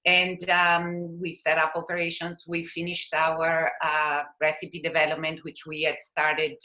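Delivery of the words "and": ordinary talk, nothing marked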